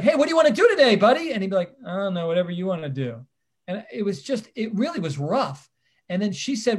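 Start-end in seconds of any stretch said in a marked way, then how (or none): 0.0-1.3 much higher and louder eager greeting; overly cheerful. Retold or imitated. Mentor chirpily greeting his mentee. 1.9-3.2 put-on bored kid. Unresponsive kid answering mentor.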